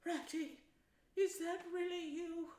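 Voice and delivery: high, feeble little voice. A narrator's reading. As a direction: weakly call out in a small high voice. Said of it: "came a feeble voice saying".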